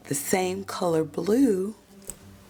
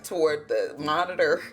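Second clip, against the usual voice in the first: ordinary delivery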